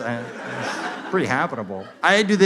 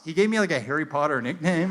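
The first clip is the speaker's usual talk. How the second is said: ordinary delivery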